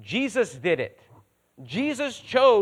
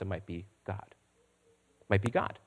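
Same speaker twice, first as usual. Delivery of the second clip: hushed repetition; 9 semitones lower, 5 dB quieter, slower than usual. Real, not acted. Repeated slowly, hushed.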